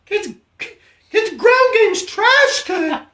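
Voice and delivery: very high, slow squeaky shout; high-pitched coach. Acted. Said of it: "I'll be the high-pitched coach".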